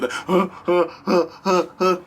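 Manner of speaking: mock fake-cry sobs that sound like a sneeze; hammed-up stuttering sobs; fake sobbing. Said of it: "They fake crying so hard".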